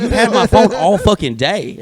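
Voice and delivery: comic outrage at her double standard; retold indignation. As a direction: protest as if wronged, for laughs. Acted quarrel over the phone.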